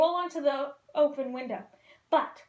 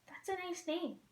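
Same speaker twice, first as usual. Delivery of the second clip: much softer, absent-minded murmur; 6 semitones higher, 10 dB quieter than usual. Acted. Dory half-listening to Nemo, murmured softly. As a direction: say it distractedly under the breath. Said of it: "Dory murmured".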